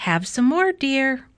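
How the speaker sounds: lilting offer, rising at the end; sleep-talking wife. A narrator's reading. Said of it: "Missus Watson said".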